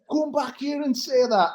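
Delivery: high, drawn-out mock challenge; retold angry challenge. Fan retelling a row with a driver, mock confrontational.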